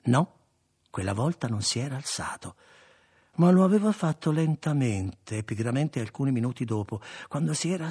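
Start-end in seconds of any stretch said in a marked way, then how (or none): none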